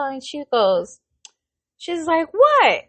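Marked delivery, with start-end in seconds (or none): 2.3-2.8 high, loud mock indignant cry of what. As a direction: play the offended old lady, pitched up and loud. Old patient indignant at her name.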